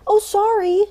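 high-pitched imitation of an offhand apology; mock apology. Retelling a careless passerby's apology.

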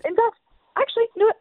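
high eager jump in; high excited realization. Real, not acted. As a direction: pounce on the example. Wife excited to find example.

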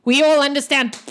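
a grim orc making his point; orc teacher. Acted. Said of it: "and he goes".

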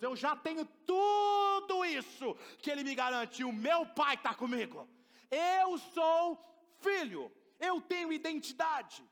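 slow, loud proclaiming; slow emphatic sermon proclamation; preaching declaration. Acted. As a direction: declare it with full conviction. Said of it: "preacher declaring identity".

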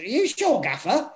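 high-pitched, doubtful kit man; Melly impression. Kit man questioning his gaffer's plan, high-pitched impression. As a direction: imitate an uneasy sidekick, pitch leaping up on the question. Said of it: "That's not a bad impression as well".

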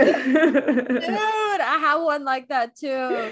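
laughing. Real, not acted. Laughing while telling own story.

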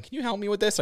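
imitating a high little voice asking for help; light, high imitated plea; high-pitched voice. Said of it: "high-pitched voice going".

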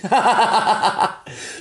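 high, put-on little laugh after the quote; a fake amused chuckle; mock laugh. Friend mocking a joke, high put-on laugh. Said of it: "she's like".

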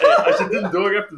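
high, giggly words; laughing. Real, not acted. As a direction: talk through the laughter. Laughing after his joke.

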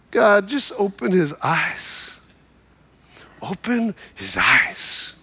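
low, slow, unmoved prayer; calm prayer. Retold or imitated. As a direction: pray it quietly and steadily. Calm prophet's quiet prayer.

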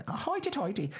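high mocking sing-song; leprechaun voice. Friend teasing him like a leprechaun, high and mocking. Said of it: "leprechauning around".

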